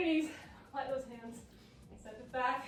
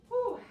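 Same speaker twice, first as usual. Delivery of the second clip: whew; 10 semitones higher, 5 dB louder than usual. Real, not acted. Exhale after final rep.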